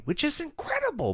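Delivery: high gushing aside; excited gush. Retold or imitated. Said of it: "character work did Kira end".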